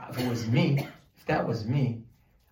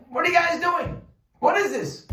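high, loud scornful taunt; mocking challenge; 4 dB louder than usual. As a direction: sneer the question at the whole table. [name] challenging at the Seder.

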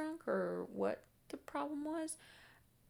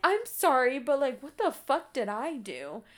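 shocked, indignant; 3 semitones higher, 8 dB louder than usual. Retold or imitated. Retelling her shocked reply to a threat.

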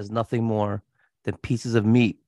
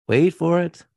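higher and louder build-up; rising suspense; 6 semitones higher, 3 dB louder than usual. Shown as a demonstration. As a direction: ramp up the suspense.

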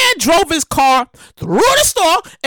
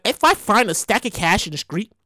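put-on low, easy country accent; light country accent, quiet and low; 6 semitones lower, 4 dB quieter than usual. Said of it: "I'm using my little country voice".

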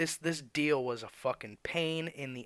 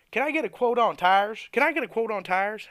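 higher, louder whiny mock caller with rising ends; 8 semitones higher, 9 dB louder than usual. Tire shop worker mimicking endless phone callers, whiny mock voice. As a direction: parrot the nagging caller, a bit hammed-up. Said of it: "phone calls I get a day for".

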